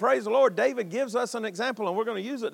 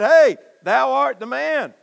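loud, slow rebuking call; a preacher's loud slow rebuke; bold rebuke; 5 semitones higher, 9 dB louder, slower than usual. Retold or imitated. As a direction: call him out to his face.

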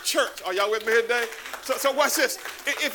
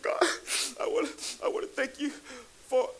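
higher, softer, tearful retelling; halting, choked-up thanks, acted out; imitated crying; 5 semitones higher, 4 dB quieter than usual. Retelling his testimony to God in church, imitated crying. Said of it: "and I was crying".

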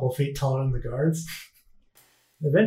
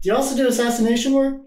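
louder repeat; 7 semitones higher, faster than usual. Real, not acted.

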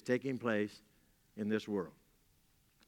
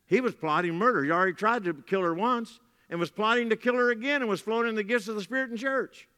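raised, higher preaching voice building in alarm; emphatic preaching; 8 semitones higher, 5 dB louder than usual. Real, not acted. Preacher warning the church about a murderous pastor, loud and high. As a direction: hammer home the pastor's crime.

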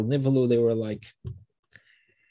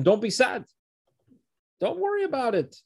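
reassuring; 12 semitones higher than usual. Retold or imitated. Joseph comforts his brothers.

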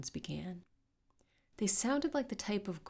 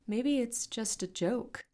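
slow, higher-pitched, tentative hope; wishful, drawn-out suggestion; hopeful; 6 semitones higher, slower than usual. A narrator's reading. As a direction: offer it hopefully. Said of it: "Ivy said with hope".

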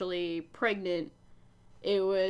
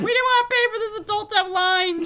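mock producer voice; 10 semitones higher, 6 dB louder, faster than usual. Mocking producers.

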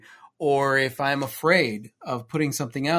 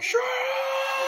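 very high yell at the dogs; mock scream. Pretending to yell at the noisy dogs, high shout. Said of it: "I wanna scream outside".

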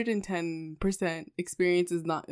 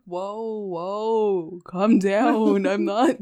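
stretched whoas building louder; mock exasperated plea; 4 semitones higher, 8 dB louder, much slower than usual. Woman retelling how she told him to calm down, exasperated. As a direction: rein him in, voice raised and pitched up. Acted plea to intense man.

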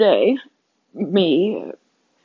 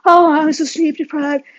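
a touch of mock-whiny drama queen, lightly higher; hammed-up but only lightly voiced self-pity; a mild mock drama queen moaning about no sleep; 5 semitones higher than usual. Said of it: "I can make myself such a drama queen".

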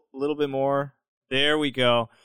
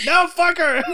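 high, loud comic yell; defiant outburst; 14 semitones higher, 7 dB louder than usual. Retold or imitated. Rejecting the girl that dumped him, defiant mock yell. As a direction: blurt it out in mock outrage.

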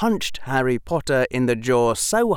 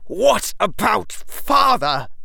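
slow, higher, louder shaking rage; trembling with rage; 12 semitones higher, 5 dB louder, slower than usual. Acted. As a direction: force it out through rage. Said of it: "His voice trembled with rage".

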